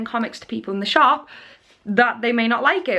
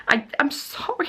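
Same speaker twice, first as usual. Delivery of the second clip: breathy whispered sorry; whispered apology; 6 dB quieter than usual. Real, not acted. Speaking to the caller on camera, a whispered apology.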